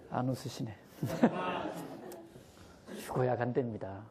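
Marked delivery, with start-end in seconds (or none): 1.0-2.2 high breathy chuckle; short high laugh. Real, not acted. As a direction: laugh at your own joke. Laughs at own joke.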